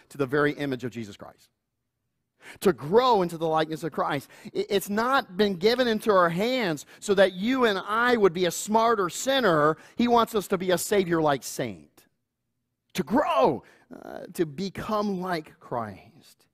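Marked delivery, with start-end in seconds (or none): none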